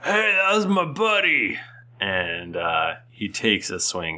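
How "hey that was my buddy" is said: high, indignant orc shout; angry orc. Acted. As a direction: yell it as the offended orc. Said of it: "one of the half orcs goes".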